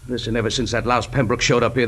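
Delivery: ordinary talk, nothing marked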